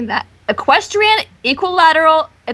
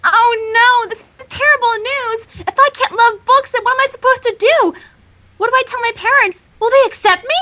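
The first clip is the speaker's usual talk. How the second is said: high, overwrought wail of despair; melodramatic Twilight; 8 semitones higher, 3 dB louder than usual. Acted. Twilight panicking to Spike about her books, overwrought. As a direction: play the crisis as a huge tragedy.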